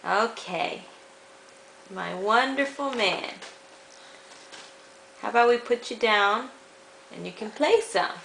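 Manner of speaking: ordinary delivery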